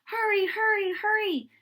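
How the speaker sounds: high, urgent rushing call; urgent hurry. A narrator's reading. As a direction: urge everyone on, high and pressing. Firemen rushing to the fire.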